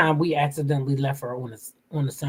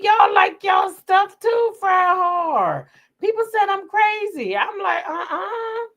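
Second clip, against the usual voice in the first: high-pitched laughing talk; 14 semitones higher, 3 dB louder, slower than usual. Real, not acted. Telling chat about her crispy food, laughing high. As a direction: squeal it through laughter.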